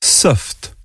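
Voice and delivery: Soft. whispered again; whispered repeat. Shown as a demonstration. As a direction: breathe Soft. gently. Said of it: "Je répète".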